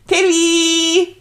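loud, high sustained call. Acted. Reader calling Tilly to ask about the book, shouting. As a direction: holler the name, holding the last vowel.

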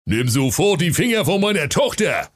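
angry father barking; thundering order. Acted. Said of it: "donnerte der Seekönig". (donnernder Befehl)

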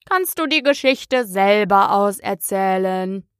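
slow, drawn-out sulky Dormouse; grumbling. A narrator's reading. Interrupted Dormouse telling Alice off, grumbling slowly. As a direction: grumble it out word by word. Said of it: "das Murmeltier bemerkte brummend".

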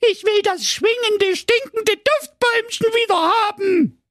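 very high, demanding princess voice; whiny princess. Acted. Princess demands the tree back from her father, shrill. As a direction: whine it out petulantly. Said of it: "so sprach sie".

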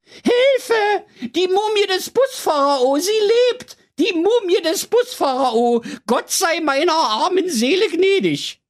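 shrill high terrified shriek; panicked screaming. Acted. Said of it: "so laut er nur konnte".

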